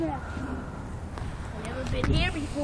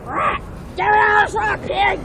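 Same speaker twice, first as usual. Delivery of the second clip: loud, drawn-out mock yell, higher pitched; mock shout; 6 semitones higher, 3 dB louder, slower than usual. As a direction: play-act a slow shouted command. Pig character's mock outburst.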